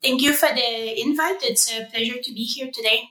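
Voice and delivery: ordinary talk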